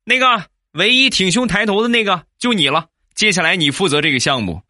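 single someone out like a boss, pitched well up; boss calling out loudly. Acted. Boss picks out the one upright employee, calling out. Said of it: "就听见我们领导大声地喊道".

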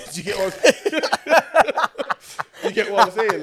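high, loud laughing question. Real, not acted. Laughs through his own question.

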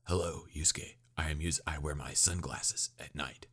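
hushed, husky villain impression; put-on villain voice, mostly whispered; Toguro. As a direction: drop to a soft, whispery menace. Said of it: "I need to use my Toguro voice".

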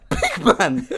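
high, laughing protest; high-pitched incredulous appeal; mock indignation. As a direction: act out disbelief through laughter. Retelling own indignant reply.

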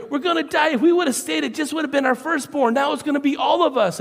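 high, loud mock panic; panicked wailing. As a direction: wail like the end has come. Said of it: "And they go".

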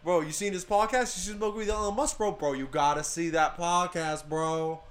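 loud, high, excited bro voice; pushy college bro hyping podcasts; frat bro. Retold or imitated.

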